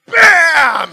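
ordinary talk

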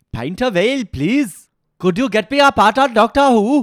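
high-pitched, shouted Welsh accent. Shown as a demonstration. Dialect coach asking for TV part, hammed-up Welsh accent. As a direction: belt it out in caricature Welsh. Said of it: "teach me to do a Welsh accent".

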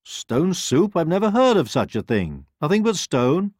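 high loud disbelief; a doubtful pig; surprised pig. Acted.

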